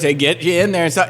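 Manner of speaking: ordinary talk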